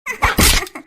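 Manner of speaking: loud mocking cackle; a short villainous laugh of triumph; gloating laugh. Woman gloats after hitting boy.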